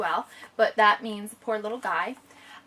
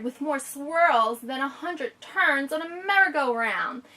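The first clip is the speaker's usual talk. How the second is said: story reading; 3 semitones higher, 3 dB louder, slower than usual. Acted. Reads the picture book aloud.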